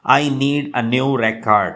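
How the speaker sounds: slow, spelled-out teaching read with "record" stressed on the first syllable, RE-cord; noun stress on record. Shown as a demonstration. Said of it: "record as a noun".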